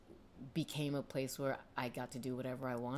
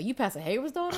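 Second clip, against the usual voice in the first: higher, louder, quicker imitation of a startled suitor; mock surprised recognition; 9 semitones higher, 6 dB louder, faster than usual. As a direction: act out a dude suddenly recognising her. Acting out dude recognising pastor's daughter.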